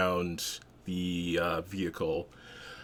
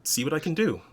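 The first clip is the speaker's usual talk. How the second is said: higher, lightly voiced helper; Rio's voice; 8 semitones higher than usual. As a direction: pitch it up, obliging. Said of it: "Rio will say".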